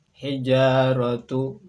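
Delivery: "Hijaratu" slow and loud, ending tu; model Arabic reading. Shown as a demonstration. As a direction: stretch out "Hijaratu". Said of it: "samakan dengan contoh pelafalan kami". ("Hijaratu" pelan dan lantang)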